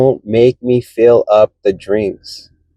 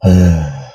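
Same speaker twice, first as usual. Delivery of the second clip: long low weary sigh; heavy sigh; 10 semitones lower than usual. Real, not acted. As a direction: let out a tired sigh. Tired at customer demands.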